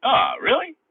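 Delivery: sharp, high, loud surprised "Ah, really?". Real, not acted. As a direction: caught off guard by the viewer's remark. Viewer says audio sounds bad.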